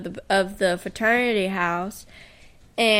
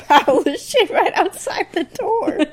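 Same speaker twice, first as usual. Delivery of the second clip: laughing; 9 semitones higher, 5 dB louder than usual. Retold or imitated. Retold threat, laughing.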